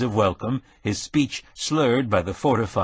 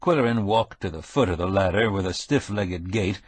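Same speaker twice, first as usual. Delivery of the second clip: ordinary delivery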